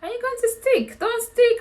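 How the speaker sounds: high, urgent plea; pleading with Dami. Retold or imitated. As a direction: beg him not to stick, voice jumping up. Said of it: "I was like".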